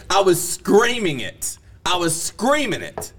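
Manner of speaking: SCREAMING drawn out, high-pitched; emphatic insistence. Real, not acted. Storyteller insisting she must have heard, emphatic. Insists how loud he was.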